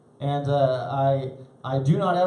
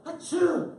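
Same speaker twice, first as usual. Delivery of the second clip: mock sneeze; 5 dB quieter than usual. Guy sneezes mid-threat.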